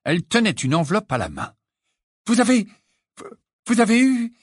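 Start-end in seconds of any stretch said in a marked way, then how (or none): 2.3-4.3 high, loud, halting eager question; breathless excitement. A narrator's reading. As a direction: burst in, too excited to finish. Hermione bursts in, overexcited.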